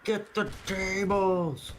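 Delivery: slow, high drawn-out yell; wrestling announcer callout. Retold or imitated.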